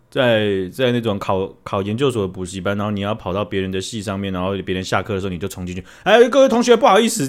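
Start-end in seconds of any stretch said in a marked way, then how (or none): 6.0-7.3 brash announcer. Pretend. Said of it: "就是要扮演这种角色".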